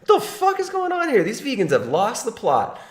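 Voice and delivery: lightly voiced baffled viewer; mock baffled viewer. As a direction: play a confused onlooker. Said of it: "some of you might be saying".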